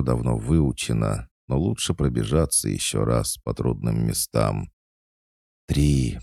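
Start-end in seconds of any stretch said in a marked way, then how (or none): none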